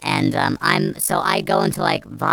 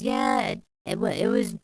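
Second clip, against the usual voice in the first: a light mock voice, higher and softer; mock confession; 3 semitones higher, 5 dB quieter than usual. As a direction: lightly play the sheepish liar. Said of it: "[name] goes".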